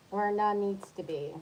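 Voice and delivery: ordinary delivery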